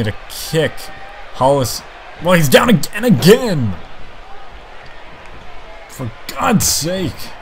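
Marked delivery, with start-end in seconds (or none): 2.2-7.3 excited shout. Real, not acted. Repeated blows, live call.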